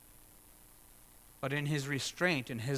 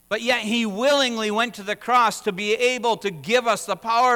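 louder, higher impassioned sermon; full-force preaching; 9 semitones higher, 9 dB louder than usual. Acted. Pastor urges the congregation, full-force preaching. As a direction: drive it home from the pulpit.

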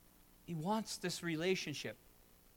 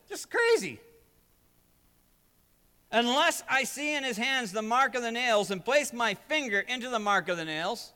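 Thomas scoffing, high and loud at first; doubting Thomas; 7 semitones higher, 8 dB louder than usual. Acted. Thomas refusing the disciples' news, loud defiant doubt. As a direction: throw out the disbelief like a stubborn doubter. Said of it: "he turns around and he says".

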